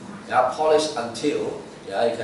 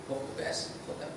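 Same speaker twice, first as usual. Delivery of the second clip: whispered echo; 14 dB quieter, faster than usual. Shown as a demonstration. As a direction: whisper "Hope the best for them" as a hushed repeat. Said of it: "whispered repeat".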